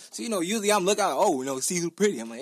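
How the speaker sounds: ordinary talk, nothing marked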